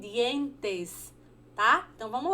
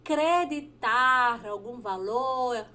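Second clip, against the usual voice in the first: slow; slower than usual. Shown as a demonstration. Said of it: "Acreditar em espanhol significa".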